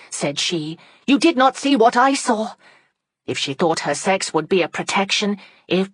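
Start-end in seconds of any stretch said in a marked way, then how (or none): 1.1-2.5 high, loud insistence; defiant retort. Acted. Margaret refusing Thornton's order, high and insistent. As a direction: fire it straight back at him.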